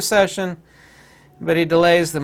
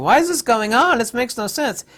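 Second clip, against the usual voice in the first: mock bewildered people; 8 semitones higher, 3 dB louder than usual. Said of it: "A lot of people are asking".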